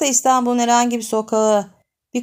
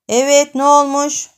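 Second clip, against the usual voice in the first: bossy demand; 5 semitones higher, 7 dB louder than usual. Acted. Older boy arriving, asking.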